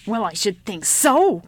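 slow, loud, grumpy growl. Acted. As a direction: growl it like an exasperated old woman. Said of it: "growled the old woman".

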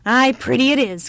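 loud, grudging grumble; grumpy old woman. A narrator's reading. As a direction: agree crossly like an old woman. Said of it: "grumped the old woman".

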